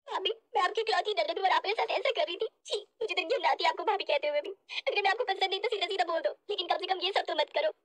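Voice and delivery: hammed-up wounded weeping; tearful complaint. Sister-in-law accusing bhabhi of harming her, tearfully. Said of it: "मत रो मेरी बच्ची".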